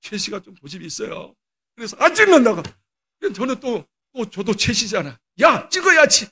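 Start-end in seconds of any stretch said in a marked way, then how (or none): 2.0-2.6 loud, high-pitched imitated child's protest; stubborn refusal. Upset daughter refusing a graduation photo, retold stubborn refusal. 5.4-6.3 loud, raised-pitch fatherly order; retold scolding. Father forcing his crying daughter into a photo, retold scolding. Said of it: "야단치며 사진 찍었잖아".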